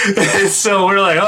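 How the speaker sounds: ordinary talk, nothing marked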